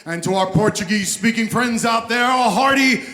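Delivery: booming fight-night ring announcer; lucha libre announcer. Real, not acted. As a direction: roll it out big like a cage fight intro. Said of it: "Sounds like he belongs in the U F C ring".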